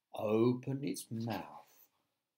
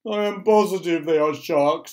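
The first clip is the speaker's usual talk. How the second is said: loud, high, doom-laden insistence; worked-up character voice; insistent earthworm; 4 semitones higher, 10 dB louder than usual. A narrator's reading. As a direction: insist loudly in alarm. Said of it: "said the other".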